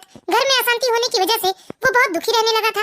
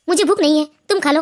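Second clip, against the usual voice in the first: much lower, tired man's voice; weary husband; 7 semitones lower than usual. Acted. Husband tells his wife he is not hungry, low and weary. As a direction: push the food away, worn down.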